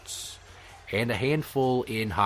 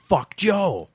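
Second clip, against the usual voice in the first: shouted across the crowd; yelled; 7 semitones higher, 4 dB louder than usual. Retold or imitated. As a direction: yell it down at him. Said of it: "I yell out".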